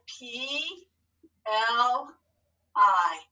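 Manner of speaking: P... L... I, each letter drawn out alone; spelling for writing. Shown as a demonstration. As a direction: dictate P, L, I with long pauses. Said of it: "Tell your hand what to write".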